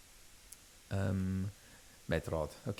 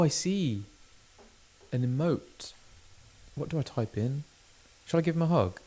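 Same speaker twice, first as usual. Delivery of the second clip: ordinary talk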